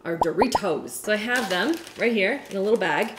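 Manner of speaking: normal talking voice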